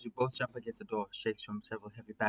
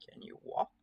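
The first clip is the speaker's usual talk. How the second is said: whisper; 4 dB quieter than usual. Acted. Hushed question to injured friend.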